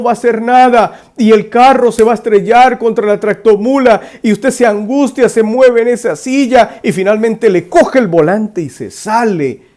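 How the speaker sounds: loud, high preacher's voice driving the story; preaching at full force. Acted. Preacher telling listeners the truck parable, fervent and loud. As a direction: preach it hard, building urgency.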